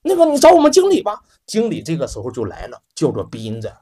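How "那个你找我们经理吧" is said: high, impatient brush-off. Acted. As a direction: push him off onto the manager. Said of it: "就有点不耐烦了".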